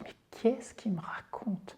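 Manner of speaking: bewildered listener's hushed whisper; baffled whispered aside. Acted. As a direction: whisper it as a confused French listener to yourself. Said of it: "vous dites".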